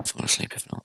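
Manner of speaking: sleepy whisper. Real, not acted. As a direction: whisper it, about to fall asleep. Sleepy, going to bed.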